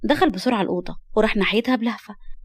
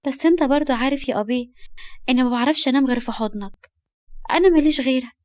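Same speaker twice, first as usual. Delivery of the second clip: put-on higher, girlish pleading; innocent pout; 3 semitones higher than usual. Girl coaxing her guardian to let her stay, innocent pout. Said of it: "كشرت ببراءة وقالت".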